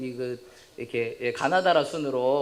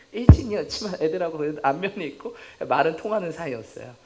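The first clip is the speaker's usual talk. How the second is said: normal talking voice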